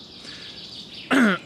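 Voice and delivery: harsh rough throat clear. Real, not acted. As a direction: clear your throat hard. Throat clearing mid-walk. (kräftiges Räuspern)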